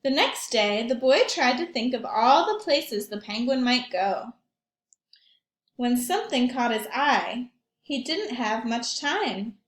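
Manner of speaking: put-on lively read-aloud for young children; story narration. A narrator's reading.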